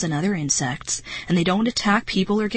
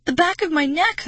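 strained, frightened, much higher; choked out; 10 semitones higher, 3 dB louder than usual. A narrator's reading. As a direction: force the words out in panic. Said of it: "I choked out".

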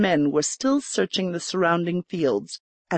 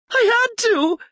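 much higher, louder, put-on indignant; forced indignation; 4 dB louder than usual. A narrator's reading. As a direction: act offended to sell the lie. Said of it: "forcing indignity into her voice".

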